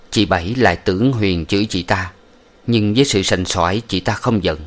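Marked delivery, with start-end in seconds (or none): none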